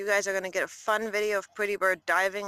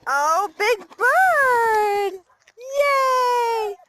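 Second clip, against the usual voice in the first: loud, high, drawn-out delighted cheer; excited cheer; 12 semitones higher, 7 dB louder, much slower than usual. Real, not acted. Owner cheering her bird as it comes running, loud and high. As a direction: cheer the bird on, stretching out the first words.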